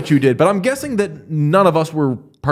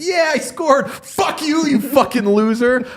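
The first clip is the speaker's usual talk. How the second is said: much higher gloating taunt, hammed up; mock gloating player; 10 semitones higher, slower than usual. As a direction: crow over a beaten rival. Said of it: "It was just like".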